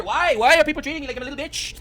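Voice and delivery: high, loud whiny mockery; mocking whine. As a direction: whine like a sore loser. Mocking the streamer's whining.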